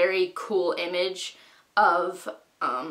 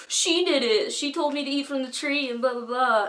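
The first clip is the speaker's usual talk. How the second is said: higher, whining finger-pointing voice; whiny blame; 7 semitones higher than usual. Retold or imitated. Adam blames Eve before God, in a whiny higher voice. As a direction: tattle like a guilty kid. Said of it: "Adam's like".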